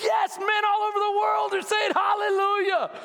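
high, exultant preacher's cry; jubilant shout. Retold or imitated. Husband cheering the psychologist's advice, jubilant shout. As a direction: cheer it out like a hallelujah.

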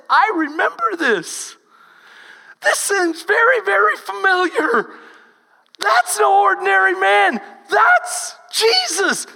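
a rising, loud cry of discovery; excited recognition. Retold or imitated. John recognising Jesus from the boat, loud excited cry. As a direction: call it out, realising who it is.

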